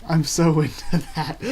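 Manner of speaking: amused leer with a laugh. Acted. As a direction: giggle it out as the flirty brother. Yonji's flirty line, laugh heard.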